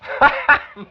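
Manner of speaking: short, high chuckle at the misunderstanding; amused laugh. Acted. Doctor laughing off the manager's seat joke, bright chuckle.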